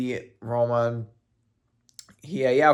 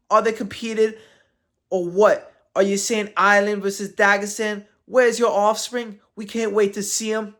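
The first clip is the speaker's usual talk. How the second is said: reading Conor's tweet; 7 semitones higher than usual. Retold or imitated. Reading a fighter's taunting tweet aloud, high mocking voice. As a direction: ham up the fighter's taunt, higher. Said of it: "I'm reading my best".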